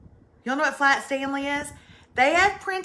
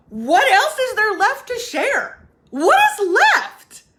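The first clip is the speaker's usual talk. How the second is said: high, loud, slow disbelief; incredulous cry; 9 semitones higher, 8 dB louder, slower than usual. Retold or imitated. Answering a commenter, high loud disbelief. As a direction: throw up your hands in mock outrage. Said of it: "I thought".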